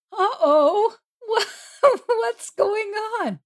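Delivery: high, laughing panicky voice; hammed-up high squeal of dismay; comic alarm. Acts out alarm at Jesus's antics.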